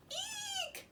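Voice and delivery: very high squeaky eek; a startled little shriek; frightened princess squeals at talking frog; mock scream. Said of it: "screamed the princess".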